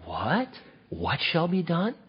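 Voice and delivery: a soft, hushed What?, leaning in for the deal; greedy curious what. Shown as a demonstration. [name] as a tax dodger asking softly about the prize. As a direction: perk up quietly at the reward. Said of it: "Do you read that as".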